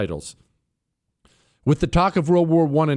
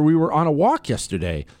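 ordinary talk

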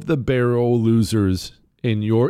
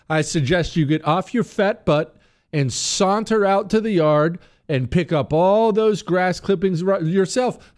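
higher, mock-indignant suggestion; defiant; 7 semitones higher than usual. Homeowner telling the association to do it, high defiant retort. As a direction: lay out the insult pointedly.

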